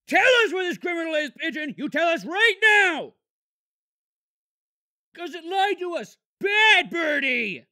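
high shouted demands at a bird; angry interrogation. Acted. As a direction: yell at the pigeon like a bad cop. Interrogating the pigeon angrily.

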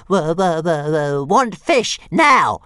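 high, loud stuttering dragon squeal; demanding baby dragon. A narrator's reading. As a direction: squeal it like a greedy little dragon. Toothless demanding fish.

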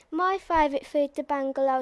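plain reading voice

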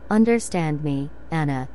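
plain reading voice

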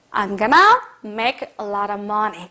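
very slow, drawn-out "I'm gonna make a lot of money"; slow model line. Shown as a demonstration. Teacher modelling a line for students, very slow. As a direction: lay it out word by word. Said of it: "그럼 어떻게 해요?".

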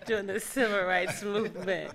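words broken by real laughter; laughing through words. Real, not acted. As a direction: laugh fondly as you remember. Laughing fondly about jail days.

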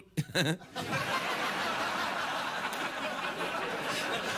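long high wheezy laugh; real breathless giggling; real laughing fit. Real, not acted. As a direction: break up helplessly mid-sentence. Breaks up during mock hypnosis.

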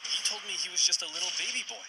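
a hint of a hurt, confused young tsar; whiny disbelief. Tricked Tsar whines in disbelief.

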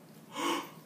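sharp gasp of alarm. Acted. Berlioz hearing the clock chime, alarmed gasp. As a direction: suck in a startled breath.

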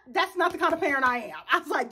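ordinary talk

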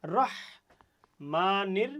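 রহমানির very slow with the h pressed in the throat; drawn-out throat h. Shown as a demonstration. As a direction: stretch রহমানির, throat tight on h. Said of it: "গলাটা কিন্তু চেপে যাচ্ছে".

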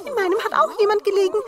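high, fast little dwarf voice; squeaky excited dwarf. A narrator's reading.

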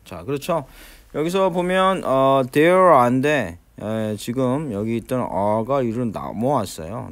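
ordinary delivery

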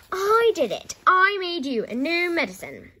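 high, loud insistent shout; shouting back. A narrator's reading. George claims the medicine to Grandma, shouting back. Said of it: "George shouted back".

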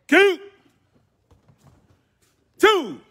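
loud high shouted rep count; counting workout reps. Real, not acted. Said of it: "I'm gonna count 'em out for you".

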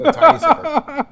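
high squeaky voice for tiny. Pretend. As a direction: pitch jumps way up, cartoonish. Joking about a tiny sip.

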